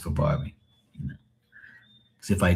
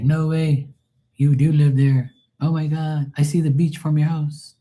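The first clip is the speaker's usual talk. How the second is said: high-pitched mock astonishment; retold amazement; 8 semitones higher than usual. Viewers amazed that he really lives there, mock astonishment.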